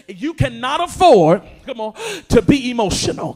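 full-force Sunday preaching. Acted. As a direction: push the words out over the crowd. Preacher building up in church.

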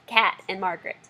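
usual speaking voice

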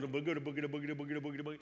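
rapid silly running sound; a preacher mimicking a hamster wheel, silly and rhythmic; hamster noise. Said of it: "And the hamster goes".

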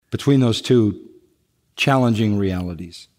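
ordinary talk

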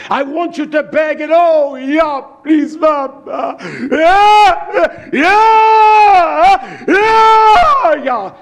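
high, loud drawn-out begging cries; wailing plea. Acted. Preacher showing mothers begging for their babies, wailing cries. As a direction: act out desperate wailing at full voice. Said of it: "cry with great urgency, with great bitterness".

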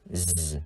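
"z" as a long voiced buzz; isolated z sound. Shown as a demonstration. Teacher shows viewers the final sound, "z" buzzed alone. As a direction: hold "z" buzzing, with no vowel. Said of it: "Âm cuối là âm".

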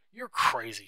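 loud, exploding outburst; angry shout. A narrator's reading. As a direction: shout it in anger. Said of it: "Tom shouted".

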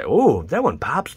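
a sudden lift of delight at the card; excited ooh. Real, not acted. Opening packs, thrilled by a shiny card, excited. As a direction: savor it slowly, impressed.

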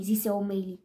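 plain reading voice

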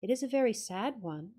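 ordinary delivery, nothing marked

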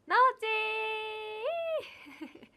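drawn-out call broken by a laugh; laughing greeting. Real, not acted. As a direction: call the name slowly, laughing. (笑いながらゆっくり呼びかける)

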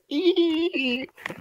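high squealing laugh burst; squeaky shriek of a laugh; weird squeal laugh. Real, not acted. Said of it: "that was the worst noise I've ever made".

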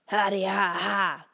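slow, loud forced wolf laugh; fake laugh. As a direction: laugh without meaning it. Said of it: "not really laughing".

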